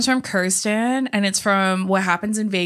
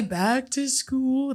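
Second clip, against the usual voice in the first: slow, higher put-on singsong; drawn-out silly imitation, pitched up; movie quote; 4 semitones higher, 4 dB quieter, much slower than usual. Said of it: "Or I always say".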